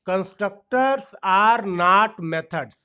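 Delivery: each word spaced out very slowly for notes; slow dictation. Shown as a demonstration. Teacher dictating a key rule to students, very slow. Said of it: "इस चीज को लिखो".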